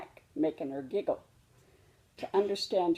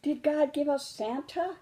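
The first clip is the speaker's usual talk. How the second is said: little cub voice; 8 semitones higher than usual. Acted. Cub keeps asking.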